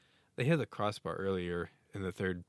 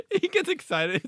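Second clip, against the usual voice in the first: much higher and louder, giddy; giddy high-pitched burst of glee; excited squeal; 8 dB louder than usual. Retold or imitated. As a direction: squeal it like the mascot is thrilled.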